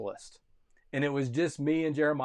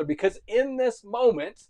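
rising, loud preacher's cry, much higher and louder; preaching climax; 5 semitones higher, 7 dB louder than usual. Real, not acted. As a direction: build to a loud cry of forsaken. Preaching at full force.

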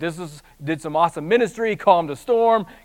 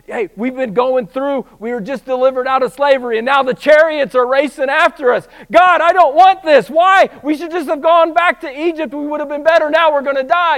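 panicked whining, much higher and louder; complaining Israelites; 8 semitones higher, 9 dB louder than usual. Acted. Israelites chased by chariots complain to God, loud panicked whine. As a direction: cry out like a frightened crowd wanting to go back.

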